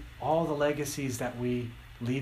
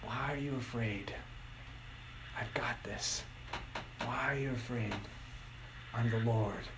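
much softer, low, near-whispered comfort; tender hush; 4 semitones lower, 9 dB quieter than usual. Acted. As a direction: breathe it like a calming promise. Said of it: "but in this".

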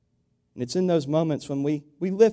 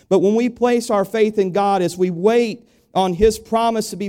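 rising, louder preaching build; fervent preaching; 9 semitones higher, 6 dB louder than usual. Acted. Pastor urging the church to be still, rising preaching. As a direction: preach it out to the congregation with growing fire.

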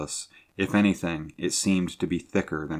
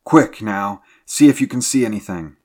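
loud urgent command, pitched up; alarmed officer's order; crying out; 4 semitones higher, 11 dB louder than usual. A narrator's reading. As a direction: hurry them along with a shout. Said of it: "he cried".

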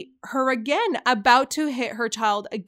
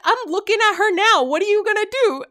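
high, louder retold challenge; defiant comeback; 9 semitones higher, 5 dB louder, faster than usual. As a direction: throw the threat back in a re-enacted dare. Acted comeback to a threat.

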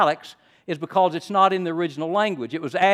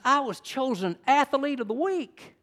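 higher-pitched retold boyish pride; excited grandson; 10 semitones higher than usual. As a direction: proudly announce good news, imitating a grandson. Retold phone call, excited grandson.

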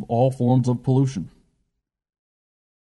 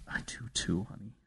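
nearly silent, much softer grief; grieving whisper; 19 dB quieter than usual. A narrator's reading. Grandmother answers grieving grandson, faint breaking hush. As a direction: let the words barely escape through grief.

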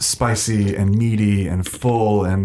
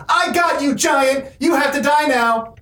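put-on little boy shouting; 13 semitones higher than usual. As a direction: yell like a triumphant ten-year-old. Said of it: "and he's like".

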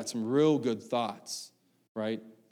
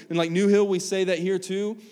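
ordinary talk

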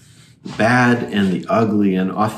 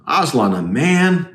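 stern echo with a touch of disbelief; sternly; 6 semitones higher than usual. Acted. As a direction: repeat it back sternly, falling at the end. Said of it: "uh, sternly".

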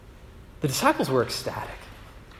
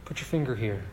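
gentle Jesus; 2 semitones lower, 12 dB quieter than usual. Retold or imitated. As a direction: coax him kindly. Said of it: "he says".

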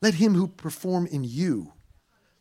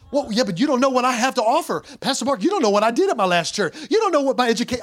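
louder, higher protesting church member; whiny excuses; 9 semitones higher, 7 dB louder, faster than usual. Acted. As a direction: protest your own importance, whining. Congregant's protesting excuses voiced.

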